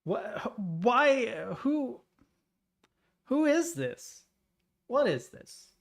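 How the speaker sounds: high-pitched confused questions; baffled. Real, not acted. Baffled reaction to the voicemail.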